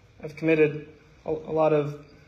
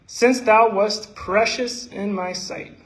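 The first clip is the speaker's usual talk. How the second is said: raised, ringing recital of Isaiah; scripture reading; 2 semitones higher, 4 dB louder than usual. Acted. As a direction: proclaim the verses to the congregation.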